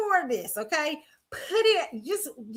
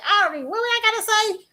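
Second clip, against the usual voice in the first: imitating a clueless [name]; 10 semitones higher than usual. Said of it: "You sit out there like".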